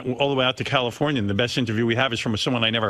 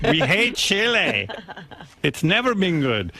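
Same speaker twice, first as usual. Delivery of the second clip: mock miner; 4 semitones higher, slower than usual. Voicing miners refusing rescue.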